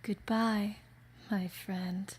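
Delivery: a lightly sinister farewell; sinister antagonist. Acted. Villain bidding farewell, lightly sinister. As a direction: say goodbye like a villain who has already won. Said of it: "deep female vocals and a lot of sinister tones".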